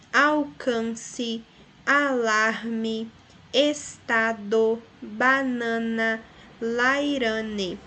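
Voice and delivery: slow, syllable by syllable; separando as sílabas. Shown as a demonstration. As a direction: draw each syllable out. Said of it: "Quando você faz a pronúncia dessa palavra". (estado e banana ditos sílaba por sílaba)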